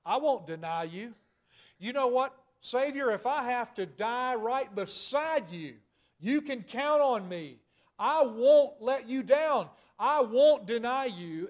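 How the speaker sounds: Peter's rash, fervent pledge to his Savior; bold vow. Retold or imitated. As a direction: swear loyalty with full conviction. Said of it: "After being so bold to affirm".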